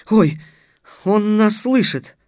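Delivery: high, loud gasp of realisation; high-pitched, drawn-out astonished delight; delighted surprise. A narrator's reading. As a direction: exclaim it slowly in happy amazement. Girl realises he hears them.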